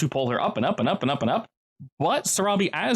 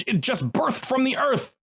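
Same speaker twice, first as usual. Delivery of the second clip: indignant; 11 semitones higher, slower than usual. Acted. GM indignant on monster's behalf.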